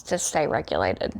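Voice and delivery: ordinary delivery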